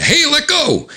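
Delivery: very high-pitched objection; high comic-book protest; protesting cry. Acted. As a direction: cry out as your bag is grabbed. Grocery bag is grabbed from Diane.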